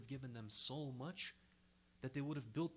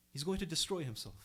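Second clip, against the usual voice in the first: ordinary delivery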